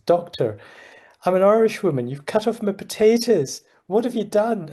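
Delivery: high-pitched, indignant Irish woman imitation; bad Irish accent. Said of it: "I can't do a very good Irish".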